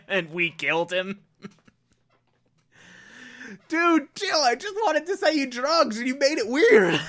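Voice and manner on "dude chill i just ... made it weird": much higher-pitched mock protest; protesting drug dealer. Drug dealer to ranting customer, high mock protest. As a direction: whine it high, on the edge of laughter.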